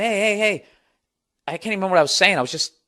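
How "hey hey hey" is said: higher pleading calls; alarmed hey. Retold or imitated. He pleads with the woman to stop, startled. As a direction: try to stop her, startled. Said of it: "I was going".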